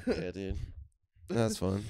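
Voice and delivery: ordinary talk, nothing marked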